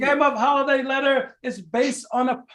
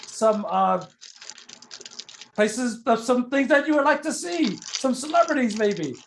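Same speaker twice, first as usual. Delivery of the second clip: normal talking voice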